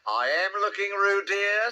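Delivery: high, firm motherly reproof; rather severe. A narrator's reading. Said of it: "said Kanga rather severely".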